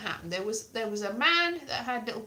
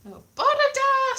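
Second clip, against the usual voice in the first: very high, loud, singsong greeting; mock-Welsh dragon; 10 dB louder than usual. Comic voicing the dragon, high loud mock Welsh. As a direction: whoop it out in bad Welsh. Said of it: "said the dragon".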